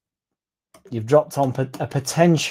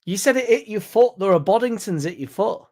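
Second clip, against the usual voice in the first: incredulous accusation; 9 semitones higher than usual. Real, not acted. Challenging friend's story.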